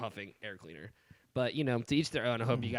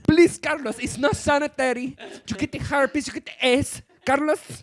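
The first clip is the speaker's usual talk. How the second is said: high, loud, scolding mom; imitating his mom; 13 semitones higher, 8 dB louder than usual. Son mimics mom's frantic health lecture, high and loud. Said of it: "And I'm like".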